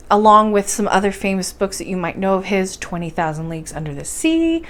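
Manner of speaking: ordinary delivery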